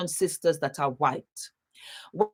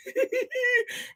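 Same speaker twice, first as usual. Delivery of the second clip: laugh; 4 dB quieter than usual. Real, not acted. Excited laugh at a viewer.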